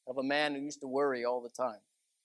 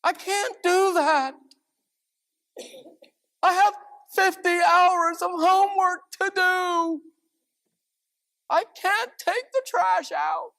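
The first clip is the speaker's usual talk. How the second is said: put-on whiny kid; 13 semitones higher, 8 dB louder than usual. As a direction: whine like a kid dodging chores. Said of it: "and they'll say".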